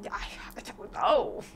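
whispered helpless shrug; whispering. Real, not acted. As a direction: breathe it out, defeated. Helpless about mic trouble.

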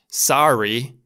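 "sorry" in an American accent, open first vowel. Shown as a demonstration. Teacher showing what Germans won't say, American-accented "sorry". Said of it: "cu un accent american".

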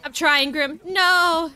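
loud protest, rising to a yell on No; real in-game yell, building; frantic calling out. Real, not acted. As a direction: shout to a teammate under pressure. Calls out to teammate mid-game.

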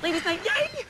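high jittery yay; overeager cheer. Acted. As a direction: push the excitement too hard.

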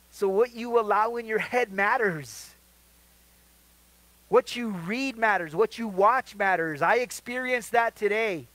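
loud, high-pitched preacher's exhortation; emphatic preaching. Real, not acted. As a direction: drive the point home at full voice. Preacher driving home his main point.

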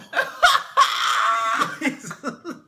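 loud, high burst of real laughter; long helpless laughing fit; hearty laugh. Real, not acted. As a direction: crack up loudly mid-thought. Cracks up over calling boy cute.

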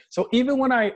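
ordinary delivery

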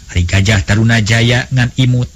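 ordinary delivery, nothing marked

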